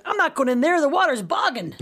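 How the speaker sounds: high, hammed-up fake American accent breaking into a laugh; terribly acted American accent. Schoolgirl refusing a swim while posing as American, badly acted accent. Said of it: "terrible acting".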